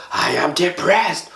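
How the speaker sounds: high, slow mock upset "I am livid"; hammed-up angry declaration; acting upset. Shown as a demonstration. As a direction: act out a charged complaint, drawn out. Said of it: "we use certain words like".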